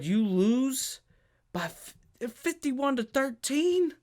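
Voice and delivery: stunned by fifty-one to thirteen; incredulous. Real, not acted. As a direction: say the score like you can't believe it. Incredulous at the blowout score.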